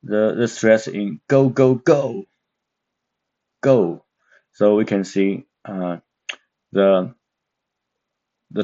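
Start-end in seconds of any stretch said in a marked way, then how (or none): 1.3-2.2 go, go, go punched high and falling; go, go, go as sharp falling commands. Shown as a demonstration. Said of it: "falling tone". 3.6-4.1 a single falling go. Shown as a demonstration. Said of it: "going down".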